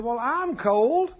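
insistent complaint. Retold or imitated. Wife insists she is cold.

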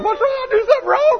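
high, loud, whiny mock sob; mock crying woman. Tearful worker asking the boss what she did wrong, mock crying. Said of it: "and they start crying".

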